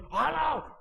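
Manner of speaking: high, comic exclamation; old man's catchphrase. Retold or imitated. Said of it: "no sé si era escritor que decía". (exclamación cómica de hombre mayor)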